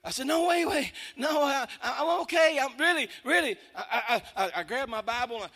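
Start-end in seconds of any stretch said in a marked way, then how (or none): 0.3-3.6 loud, high, stammering protest; frantic reassurance. Retold or imitated. Man stopping a woman calling nine one one, frantic reassurance. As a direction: scramble to convince her you're okay.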